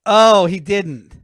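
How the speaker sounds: slow, loud, high gasp of disbelief; drawn-out stunned reaction; shocked disbelief. Real, not acted.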